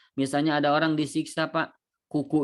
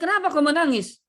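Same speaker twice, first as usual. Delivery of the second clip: much higher and louder, pressing; exasperated question; 12 semitones higher, 5 dB louder than usual. Acted. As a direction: demand an answer, exasperated. Last time asked. (orang-orang bertanya dengan keras dan tinggi, tidak sabar)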